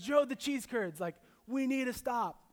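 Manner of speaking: hammed-up wife begging for cheese curds; imitating wife, pleading. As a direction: whine it out like an eager wife begging to stop. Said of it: "That's how she speaks".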